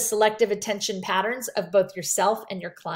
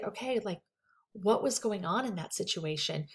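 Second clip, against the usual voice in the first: much softer, leaning in; matching soft tone; 7 dB quieter than usual. Shown as a demonstration. Counselor softly asks a quiet client what happened. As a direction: meet a quiet client at their volume. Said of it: "lean in and just say".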